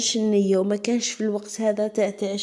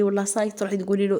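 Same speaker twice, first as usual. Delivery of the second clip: usual speaking voice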